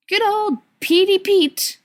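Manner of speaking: high, slow sing-song taunt; high mocking sing-song. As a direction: sneer it like a playground nickname. Mocking nickname for Peter.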